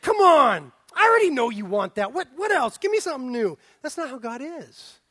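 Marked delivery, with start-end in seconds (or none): 0.0-3.6 impatient, loud mock complaint; a put-upon voice hammed up; mock bored listener. Preacher voicing a bored God, impatient.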